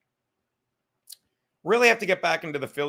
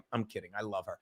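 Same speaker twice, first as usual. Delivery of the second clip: quiet aside; 9 semitones lower, 13 dB quieter, faster than usual. Real, not acted. Joke about wife, quick retraction.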